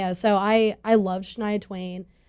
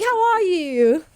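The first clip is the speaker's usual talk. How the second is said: bad Australian accent; 8 semitones higher, much slower than usual. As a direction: stretch it out in a mock Australian twang. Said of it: "My terrible accent".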